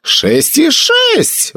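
high, slow stunned cry; shocked exclaim. Acted. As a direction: gasp it out in disbelief. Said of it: "воскликнул Маград".

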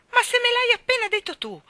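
Davy protesting to Anna, aggrieved. Acted. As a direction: complain you've been wronged. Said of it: "protestò Davy afflitto".

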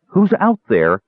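clear crystalline voice. A narrator's reading. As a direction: call out into the dark. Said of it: "called a clear, crystalline voice".

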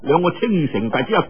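usual speaking voice

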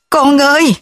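high, ringing call; calling loudly. A narrator's reading. Mother calling for her missing son, loud. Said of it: "Cô gọi to".

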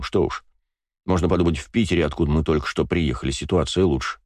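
ordinary delivery

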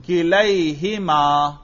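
کلیہما very slowly, with a long lai diphthong; ya after fatha. Shown as a demonstration. Teacher showing the nasb and jarr form, drawn out. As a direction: stretch the lai in کلیہما, syllable by syllable. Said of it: "یا ما قبل مفتوح".

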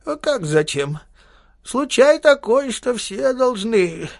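put-on high, slow old man who insists; stubborn little old man holding his ground; lightly voiced old codger, slow and firm; insistent old man. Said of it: "спокойным тоном человека, решившего настоять на своём".

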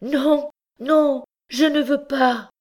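loud, high scream of refusal; high, heart-rending cry. A narrator's reading. Condemned girl feeling the rope at the gallows, heart-rending cry. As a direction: cry out piercingly in terror. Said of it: "cria d'une voix haute et déchirante".

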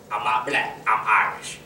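a hint of an old Southern comic claiming to be Irish.

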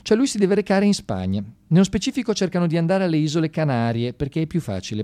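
normal talking voice

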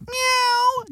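high imitated miaow; cat meow. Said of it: "meow means".

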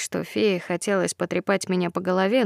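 usual speaking voice

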